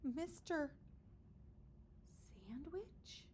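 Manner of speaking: hushed, whispered name; murmured. Acted. Children murmur the teacher's name, whispering. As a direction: breathe it in awe. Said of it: "the children murmured".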